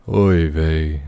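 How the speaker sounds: a hint of a Yiddish lament; oy vey lament. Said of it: "Fievel's dad singing".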